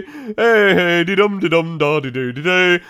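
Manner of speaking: loud sing-song babbling to himself; babbling noises. Retold or imitated. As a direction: hum nonsense happily like a dazed man. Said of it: "making noises to himself".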